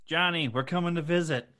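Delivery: high, quick call at the door; hammed-up, high-pitched visitor's holler. Tipsy adults visiting neighbours at Halloween, calling out high.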